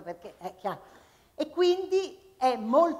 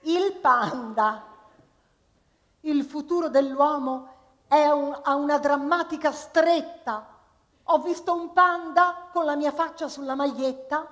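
recited with comic timing, louder; poem recital; 5 semitones higher, 6 dB louder than usual. Acted. Performer reciting a comic poem to the audience. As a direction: deliver the short poem for laughs.

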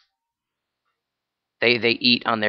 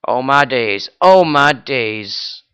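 ordinary delivery

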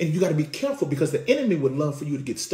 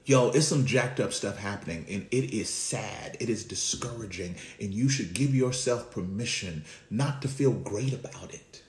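preaching at full force; 5 semitones higher than usual. Acted. Preacher to congregation, rising to a full-force cry. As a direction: lift the congregation, preaching hard.

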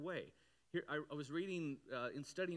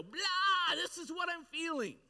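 much higher, much louder unfiltered blurt; hammed-up gush of feeling; mock emotional outburst; 12 semitones higher, 8 dB louder, slower than usual. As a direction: spill it all out like someone who can't hold it in. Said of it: "you just go".

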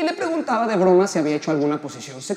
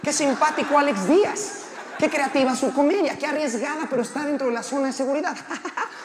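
higher voice with put-on chuckles; acted laughing along; 4 semitones higher than usual. Comedian laughing at a colleague from the dressing room, acted laughing. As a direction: chuckle through admiring praise. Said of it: "yo estaba riéndome desde el camerino".

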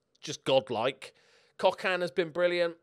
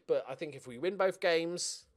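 normal talking voice

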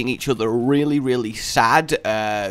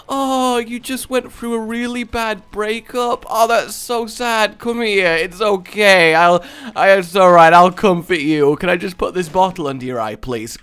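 fake sympathy; 5 semitones higher, 5 dB louder than usual. Tear collector pretending to comfort a heartbroken friend, mock sympathetic. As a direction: coo over them while holding out a bottle.

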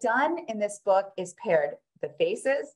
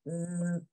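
1 sleepy snore; 8 dB quieter than usual. Acted. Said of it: "When I'm super sleepy".

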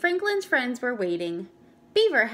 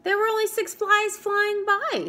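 defensive boast; 10 semitones higher, 4 dB louder than usual. Acted. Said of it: "said Franklin".

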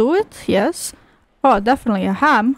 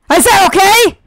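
shouted, higher I said okay with okay punched; loud demand; 7 semitones higher, 6 dB louder than usual. Real, not acted. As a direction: bark the demand to force an answer. Repeats demand shouting at character.

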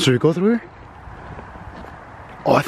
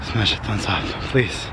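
out of breath after running; panting between words; running out of air. Real, not acted. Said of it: "I'm running out of breath".

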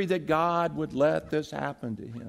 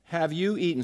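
ordinary talk

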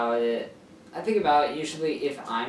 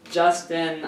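plain reading voice

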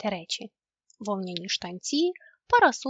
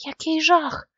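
louder cry of dismay; louder, alarmed exclamation; exclaiming in horror; 4 semitones higher, 7 dB louder, slower than usual. A narrator's reading. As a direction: gasp it out as a horrified exclamation. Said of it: "вигукнув Мумітроль".

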